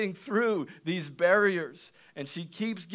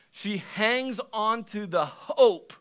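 ordinary talk

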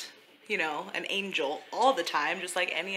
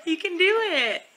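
encouraging cheer; 10 semitones higher, 5 dB louder than usual. Real, not acted. Cheering baby on slide.

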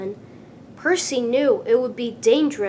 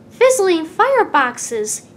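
higher, louder startled cry; surprised exclamation; 4 semitones higher, 6 dB louder than usual. A narrator's reading. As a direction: blurt it out in alarm. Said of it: "he peeped".

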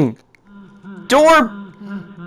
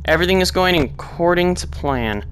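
much softer, lower self-soothing; calming himself; 6 semitones lower, 8 dB quieter than usual. Real, not acted. Player calming himself down after dying. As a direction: quietly talk yourself down.

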